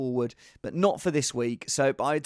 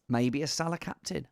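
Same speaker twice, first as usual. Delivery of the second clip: usual speaking voice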